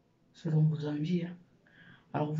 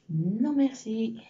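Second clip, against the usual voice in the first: mock refusal; 5 semitones higher, 6 dB louder, much slower than usual. Mock refusal of curly hair.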